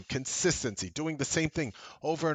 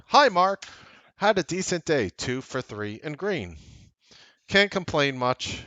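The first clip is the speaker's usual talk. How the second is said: brighter, louder reading voice for a viewer's email; email read-aloud; 2 semitones higher, 5 dB louder than usual. Acted. Host reading a viewer's email aloud, louder and brighter. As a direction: read the viewer's note out to the show.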